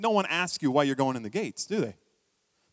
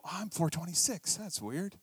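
puzzled customer; 10 dB quieter than usual. Retold or imitated. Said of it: "and you go".

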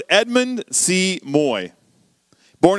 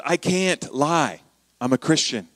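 quiet conviction; 3 dB quieter, slower than usual. Retold or imitated. Edmund's quiet stand.

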